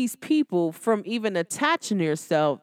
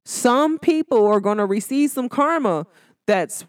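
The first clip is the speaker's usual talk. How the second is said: ordinary talk, nothing marked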